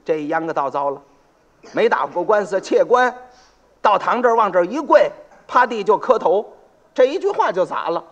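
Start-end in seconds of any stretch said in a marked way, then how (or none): none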